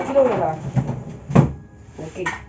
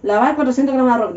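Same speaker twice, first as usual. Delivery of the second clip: ordinary talk, nothing marked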